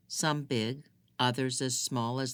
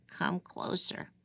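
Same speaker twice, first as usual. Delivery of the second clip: slow breathy whisper, drawing her in; whispered beckon; 6 semitones higher, 3 dB quieter, slower than usual. A narrator's reading. Prisoner lures the girl toward the cell bars, whispered. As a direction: whisper it like bait.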